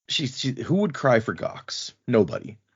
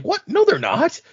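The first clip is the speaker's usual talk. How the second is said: Wendell protesting; 4 dB louder than usual. Retold or imitated. Wendell protesting in retold story.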